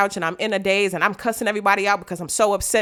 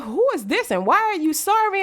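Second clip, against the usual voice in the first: puzzled; 10 semitones higher than usual. Retold or imitated. Confused reading of message.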